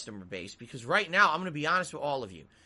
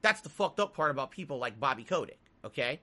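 ordinary talk

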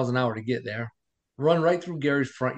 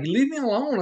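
high-pitched mock yell; shouting at doctor and nurses, high mock yell; retold screaming; 11 semitones higher than usual. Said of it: "I started screaming at the doctor".

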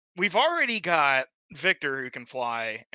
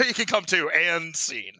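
normal talking voice

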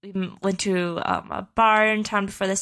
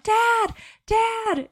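high-pitched calling out; mock scream for a parent across a crowd; re-enacted screaming. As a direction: call out high, without full volume. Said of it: "Why am I screaming, 'Dad'?".

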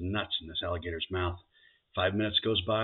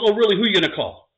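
higher and much louder, indignant; challenging wife; 12 semitones higher, 11 dB louder than usual. Acted. Bride challenging her husband, loud and high. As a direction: fire it back at him. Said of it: "She goes".

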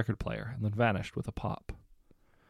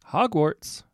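louder called destination; 8 semitones higher, 8 dB louder than usual. A narrator's reading. Lorraine in the fireplace calls out her destination, loud. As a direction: call the word out clearly. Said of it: "she called".

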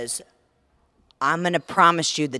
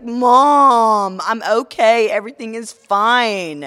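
loud, higher imitation of the son; exasperated son; 6 semitones higher, 9 dB louder, slower than usual. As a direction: reassure Mom insistently, pitched up. Voicing son reassuring his mother.

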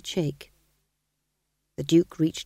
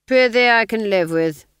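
louder, clipped aristocratic command; cold Duke; 5 semitones higher, 9 dB louder than usual. Acted. Duke dismissing the sergeant: louder cold command. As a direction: dismiss him like a bored lord.

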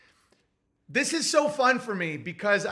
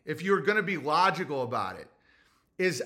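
ordinary delivery

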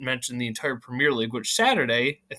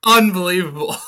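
laughing exclamation; 10 semitones higher, 7 dB louder than usual. Real, not acted. Reacting to matching number.

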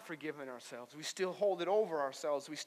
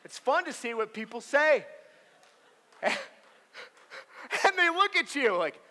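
ordinary talk

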